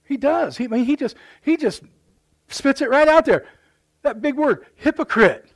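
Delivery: high, loud preaching that peaks on the word hypocrite; full-force preaching. Acted. Preacher hammering hypocrisy to his congregation, high and loud. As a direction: spit it out like a fiery preacher.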